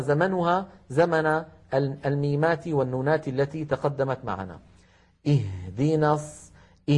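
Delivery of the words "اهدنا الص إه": "إه" with a held, breathed ha; audible flowing ha. Shown as a demonstration. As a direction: let breath run through the ha of "اهدنا". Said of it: "لاحظوا صوت الهاء". («إه» بهاء ساكنة جارية الصوت)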